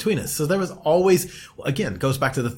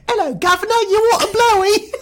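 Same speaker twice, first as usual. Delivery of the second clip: much higher, louder hammed-up cockney voice; cockney impression; 7 dB louder than usual. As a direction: ham up a squeaky cockney lady offering it. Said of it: "Let me see if I can do the, the voice".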